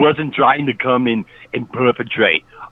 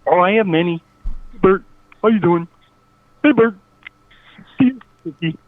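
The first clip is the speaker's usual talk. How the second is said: higher cartoon frog puppet voice; Kermit the Frog; 6 semitones higher than usual. Caller greeting Muppet friends in a higher puppet voice. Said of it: "Was that Kermit the Frog".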